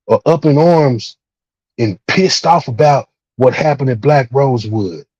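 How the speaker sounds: ordinary delivery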